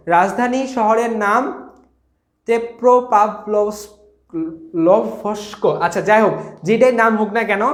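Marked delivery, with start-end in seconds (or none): 2.5-5.7 very slow, halting sounding-out; labouring over a hard word, syllable by syllable; tongue-twister name. Real, not acted. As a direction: stumble through a foreign name piece by piece. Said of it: "এগুলোর নাম পড়তে গেলে দাঁত ভাঙবে".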